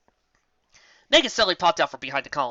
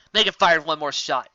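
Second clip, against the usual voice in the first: ordinary delivery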